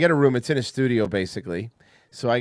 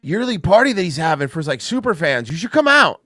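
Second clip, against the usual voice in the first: hyped-up buddy voice, much higher and louder; high, loud party-bro impression; imitating Alex; 8 semitones higher, 5 dB louder than usual. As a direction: pump it up like an excited bro inviting a friend. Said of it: "reading it as how Alex would say it".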